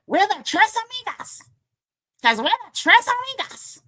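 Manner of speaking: high-pitched insistent Tres Amigas; imitating Vicky. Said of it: "That's how she says it".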